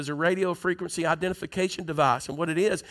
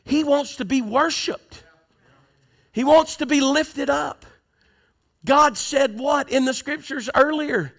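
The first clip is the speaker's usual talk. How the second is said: high, slow preaching swelling louder; rising preaching; 7 semitones higher, 5 dB louder, slower than usual. Real, not acted. Preacher turning his church back to scripture, rising slow preaching. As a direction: lift it back up to the pulpit.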